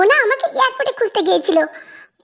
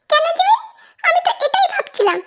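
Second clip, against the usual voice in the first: giggling; 8 semitones higher than usual. Acted. Tae teasing Jimin, laughing.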